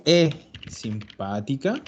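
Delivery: slow, with the "e" of "e simpática" stretched and stressed; marked e. Shown as a demonstration. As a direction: lean on the "e" so it sounds like a verb. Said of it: "yo ahí estoy marcando la, la E".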